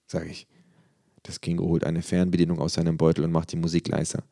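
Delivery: plain reading voice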